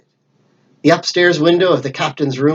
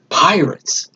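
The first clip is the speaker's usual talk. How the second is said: breathy hushed whisper of fear; frightened whispered thought; 3 semitones higher than usual. Acted. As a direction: barely breathe the word, hiding. Hero hiding, thinking in fear.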